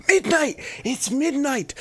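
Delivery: excited high shout; alarm shout. Real, not acted. Release hits midnight on stream.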